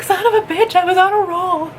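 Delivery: high-pitched mock outrage; retold exasperation. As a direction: whine in hammed-up frustration at being interrupted. Retold annoyance at interruption.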